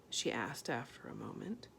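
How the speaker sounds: ordinary delivery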